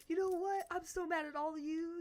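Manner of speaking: hammed-up scolding; mock mad teacher. Teacher scolding the class after the bug, in mock anger.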